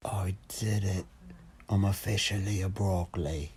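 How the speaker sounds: intercom voice filter; filtered Snapchat intercom voice; voice changer. Real, not acted. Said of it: "I'm gonna do that right now".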